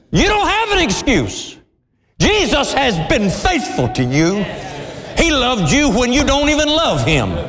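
high, impassioned preacher's cry; fervent preaching. Acted. Preacher pleading with the congregation, high fervent preaching. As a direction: drive it home to the congregation.

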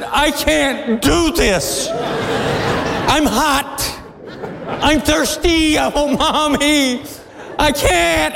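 put-on high, dragged-out child's wail; whining little girl. Hot little girl giving up on a walk, whining. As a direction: imitate a worn-out small girl, high and plaintive. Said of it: "She said".

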